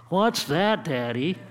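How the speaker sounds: lightly voiced little girl asking her dad; young daughter. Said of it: "She looks and she says".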